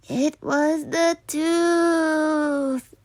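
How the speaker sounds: very slow, crying whine; sick Stuart blaming the tooth; aching blame. Acted. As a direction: whimper it out slowly.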